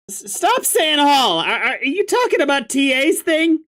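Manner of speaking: sarcastic grumpy troll. Acted. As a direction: spit sarcasm, voice pitched high.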